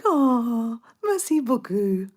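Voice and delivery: loud, high, drawn-out flattered thank-you; re-enacted gushy French thanks, slow and lifted; gushing thanks. Tourist thanking a shop worker who called her sweet, gushing.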